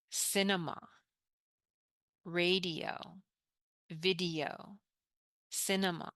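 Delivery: slow, clear repeat-after-me words; careful English teacher modelling; model pronunciation. Shown as a demonstration. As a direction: model each word for students to echo. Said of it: "we pronounce this".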